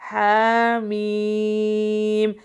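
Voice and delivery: very slow loud drawn chanting of the letters; model recitation. Shown as a demonstration. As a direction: stretch every مد long and full. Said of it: "ہا کو ایک الف کے برابر کھینچیں گے".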